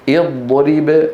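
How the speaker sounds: اضرب started with i, "idrib"; opening kasra. Shown as a demonstration. Teacher showing the correct start, with kasra. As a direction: begin اضرب on a clear i. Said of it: "نكسر".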